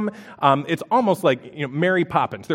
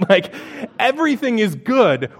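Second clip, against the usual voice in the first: ordinary talk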